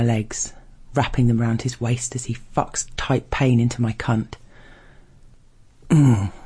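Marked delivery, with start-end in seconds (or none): 5.9-6.3 loud falling grunt; grunting, rasping. A narrator's reading. Narrator imitating the stranger mid-sex, rasping grunt. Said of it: "Grunting, rasping".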